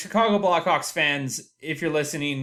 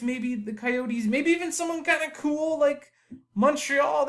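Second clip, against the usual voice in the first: hopeful inner voice; 8 semitones higher than usual. Retold or imitated. Fan retelling his morning hopes to listeners, wistful higher voice. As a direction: talk himself into false hope, lightly voiced. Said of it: "but I'll tell myself".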